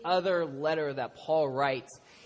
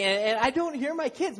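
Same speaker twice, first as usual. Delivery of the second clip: ordinary talk, nothing marked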